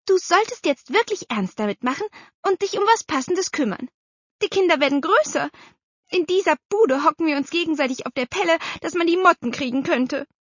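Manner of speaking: high, brisk, insistent; nagging aunt. A narrator's reading. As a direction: press your brother to act at last. (drängende Tante, hoch und schnell)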